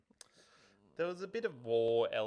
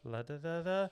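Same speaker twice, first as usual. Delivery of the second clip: put-on child's singsong spell; 4 semitones higher, 10 dB louder than usual. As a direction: play the little girl waving her wand. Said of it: "And she goes".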